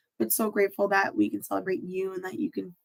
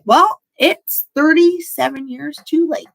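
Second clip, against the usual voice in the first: slower, higher, louder bitter retort; bitter inner voice; 7 semitones higher, 9 dB louder than usual. Retold or imitated. Imagining a younger self's resentment, bitter. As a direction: throw it out like a resentful thought. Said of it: "there could have been a stage in your life where it was like".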